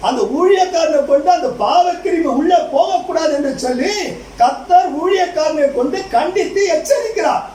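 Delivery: forceful pulpit warning; preaching. Acted. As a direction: proclaim it to the congregation. Preaches with raised pitch.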